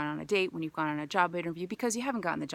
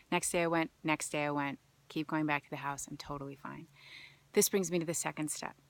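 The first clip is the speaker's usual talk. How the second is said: usual speaking voice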